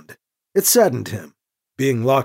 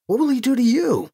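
higher-pitched, worried question; mildly anxious, pitch raised; concerned; 9 semitones higher than usual. A narrator's reading. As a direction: ask with concern for the other person.

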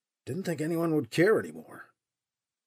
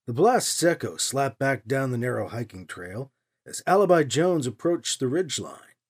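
plain reading voice

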